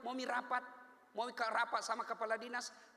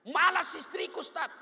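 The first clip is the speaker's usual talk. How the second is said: complaining husband; 6 semitones higher, 8 dB louder than usual. Retold or imitated.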